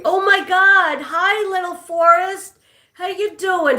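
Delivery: high, loud delighted hello; excited greeting. Real, not acted. Vlogger greeting a Hungarian viewer, excited and high.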